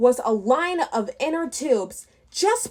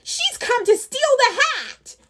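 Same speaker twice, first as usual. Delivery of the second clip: high goblin accusing; alarmed goblin; 12 semitones higher than usual. Acted. Goblin warns the others about the fairy, high and alarmed. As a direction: squeal the accusation, panicked.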